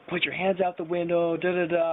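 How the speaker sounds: high droning police orders; mock loudspeaker cop. Cop repeating orders to drunk passenger, high mock loudspeaker voice. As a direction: imitate loudspeaker commands, skimming the words. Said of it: "he told me again".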